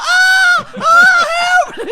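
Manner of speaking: very high, very loud scream for help; re-enacted screaming. Comedian re-enacting being dragged off stage, full-volume mock screaming. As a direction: scream it out, hammed-up panic. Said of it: "I'm pure screaming".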